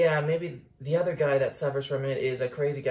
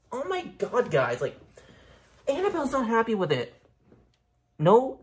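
ordinary talk

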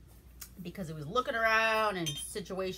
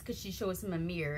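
usual speaking voice

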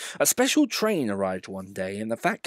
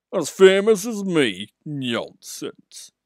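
much higher, louder, slow haughty big engine; indignant Gordon; 7 semitones higher, 7 dB louder, much slower than usual. Acted. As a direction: scoff like a vain engine.

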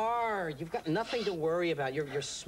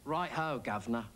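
low, mock-British send-off; mock British accent; 6 semitones lower than usual. Man answering the English caller in kind, mock British accent. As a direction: put on a British accent, dropping lower.

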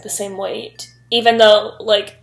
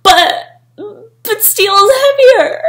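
confused man; 9 semitones higher, 4 dB louder, slower than usual. Retold or imitated. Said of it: "He's like".

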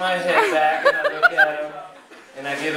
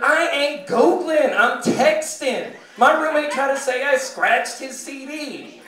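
much higher, loud, indignant guard; security guard retorting; 9 semitones higher, 3 dB louder, slower than usual. Acted. Security guard to the desk lady, indignant retort. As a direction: snap back in a high voice, stretching the key words. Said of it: "he looks up at her".

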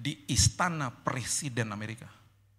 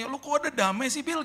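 mock amazed friend; 11 semitones higher than usual. Friends' amazed question quoted.

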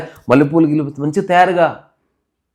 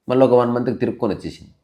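ordinary talk

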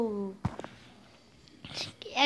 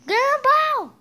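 calling out; 6 semitones higher, 4 dB louder than usual. Acted. Darwin calls Gumball again.

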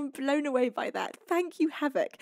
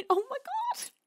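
touched, near tears; 13 semitones higher than usual. Real, not acted. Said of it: "You're gonna make me cry".

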